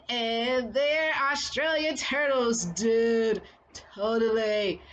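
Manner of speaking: a bad Australian accent, drawn out and loose. Fan imitating the Australian turtles in a bad Australian accent. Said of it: "that's not a good accent".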